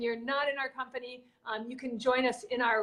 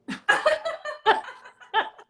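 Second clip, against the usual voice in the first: loud high laugh; 12 semitones higher, 8 dB louder than usual. Real, not acted. Host laughing hard after telling the dog joke, loud and high. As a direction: burst of high laughter at her own punchline.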